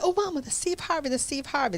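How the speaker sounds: high, fast starstruck gasp to Mama; excited. Retold or imitated. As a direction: blurt it out, thrilled to see him. Spotting Steve Harvey from the limo.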